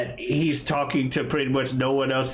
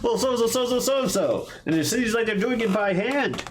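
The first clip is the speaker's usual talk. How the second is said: higher, bright shopkeeper chatter; high-pitched chatty voice; 6 semitones higher than usual. Retold or imitated. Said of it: "he's like".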